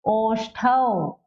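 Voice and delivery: slow ओष्ठौ, ending in a drawn-out "a-o"; final au diphthong. Shown as a demonstration. Teacher showing how the au matra reads, slow final au. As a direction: stretch the au glide ending ओष्ठौ. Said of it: "इसलिए क्या पढ़ेंगे".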